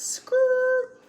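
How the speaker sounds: loud high mock tire screech "skirt"; shrill skid sound effect. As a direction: screech to a halt like brakes. Sudden stop, paused in tracks.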